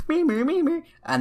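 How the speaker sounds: very high, loud beeping voice for the scanner; mock alarm beep. Imagining the scanner going off on him, a loud high mock beep.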